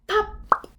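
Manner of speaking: squeaky high pop; sudden pop. Acted. Teacher acts out the balloon popping. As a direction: burst it like a balloon.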